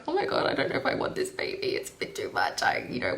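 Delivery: hushed, breathy doubting whisper. Retold or imitated. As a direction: whisper the doubts like a secret confession. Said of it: "I was a bit like".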